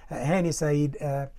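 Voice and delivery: usual speaking voice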